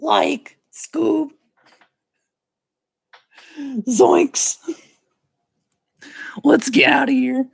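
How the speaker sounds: high, cracking cartoon sidekick; cartoon character voice. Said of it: "I'm doing voices".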